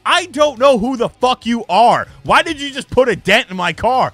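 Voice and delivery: high, loud mock outrage; angry confrontation. Imitating a driver whose car got dented, angry yelling. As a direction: yell it like a furious car owner.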